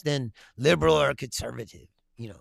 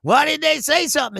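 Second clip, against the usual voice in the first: high, loud mocking whine of his critics; mocking whiny critics; 10 semitones higher, 5 dB louder than usual. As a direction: ham up the outraged accusers, shrill and shouting. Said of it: "They're like".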